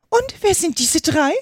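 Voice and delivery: high-pitched imperious queen; herrische Königin. A narrator's reading. Herrische Königin zeigt auf die Gärtner. (herrische Königin mit hoher Stimme)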